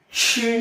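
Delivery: সে as a long hissed syllable; throaty সে. Shown as a demonstration. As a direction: push air through a drawn-out সে. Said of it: "গলা থেকে উচ্চারণ করব".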